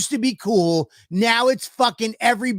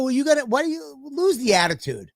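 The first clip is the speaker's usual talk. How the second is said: higher, challenging question; pitched-up mock challenge; mock indignant; 8 semitones higher than usual. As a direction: push back on her attitude. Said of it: "I go".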